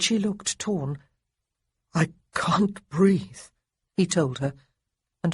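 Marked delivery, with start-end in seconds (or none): 1.9-3.5 slow, labored words; feverish gasp. A narrator's reading. Sick Marcus to Honoria, slow and labored. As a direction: drag each word out.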